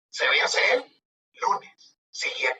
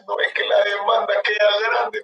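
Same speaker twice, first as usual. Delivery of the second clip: higher-pitched imitation of a distressed client; mock tearful complaint; 5 semitones higher than usual. Procurador confessing a missed deadline to his lawyer, mock tearful. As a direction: plead as if close to tears, lightly acted. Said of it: "lo veo llorando".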